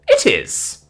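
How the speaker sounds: high, emphatic confirmation; imperious, pitched well up; curt haughtiness. Acted. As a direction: confirm it like an affronted matron. Haughty dame confirms her threat.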